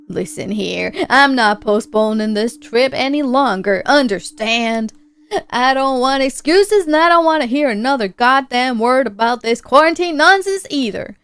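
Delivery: blustering put-on character voice; stern, fed-up man. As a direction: lay down the law with rising force. Voices Red laying down law.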